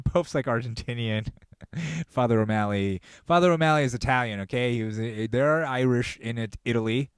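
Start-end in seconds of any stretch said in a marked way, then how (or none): none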